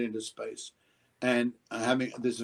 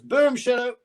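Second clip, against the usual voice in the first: high, louder crew call; 12 semitones higher, 5 dB louder than usual. Retold or imitated. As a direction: ruin the moment with a flat call. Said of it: "somebody goes".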